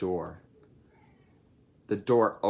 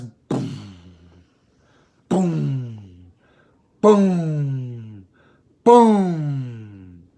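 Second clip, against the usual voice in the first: booming from firm to huge, louder each time; building booms; 6 dB louder, much slower than usual. A narrator's reading. Narrator voicing the volcano, booms swelling louder. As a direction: let each boom grow bigger and louder.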